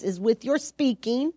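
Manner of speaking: ordinary delivery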